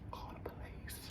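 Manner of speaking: hushed whispered aside. Acted. Telling viewers what to do about stalkers, in a whisper. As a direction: whisper it like a secret tip.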